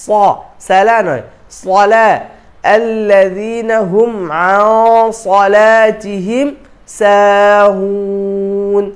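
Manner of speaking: slow recitation with heavy ص in صلاتهم; slow tajweed recitation. Shown as a demonstration. Teacher recites the corrected verse for students. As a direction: recite slowly and melodically. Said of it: "এই জন্য গুন্নাটা মোটা হবে".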